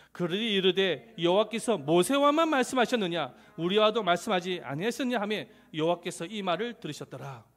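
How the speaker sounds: loud recited Bible verse; scripture reading aloud. Acted. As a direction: lead the congregation in reading scripture, loudly.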